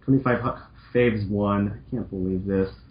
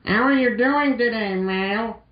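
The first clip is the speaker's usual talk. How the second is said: put-on higher, bright girl-robot voice; cheerful Roll voice; 11 semitones higher than usual. Roll greeting Mal cheerfully in a higher voice. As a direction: play sweet Roll greeting her friend. Voicing Roll in fan fiction.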